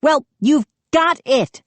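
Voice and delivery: high indignant exclamation; exclaiming. A narrator's reading. Mindy answering the gnome, sarcastic and indignant. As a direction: snap it back, indignant. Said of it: "Mindy exclaimed".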